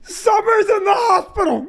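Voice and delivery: high wailing sob, imitating his wife; mock hysterical crying. Husband mimicking wife's hysterical phone call, mock sobbing. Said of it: "hysterically crying".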